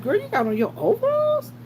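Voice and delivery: high, incredulous question; mock surprise. Teasing a coworker in overalls, high and incredulous. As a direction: tease her about the overalls. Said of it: "I was like".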